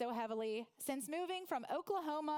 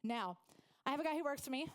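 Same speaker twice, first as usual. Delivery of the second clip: ordinary talk, nothing marked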